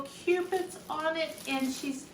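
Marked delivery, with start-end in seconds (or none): none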